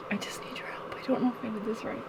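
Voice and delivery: hushed pleading whisper. Acted. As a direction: whisper it like a secret plea. Mock plea to camera.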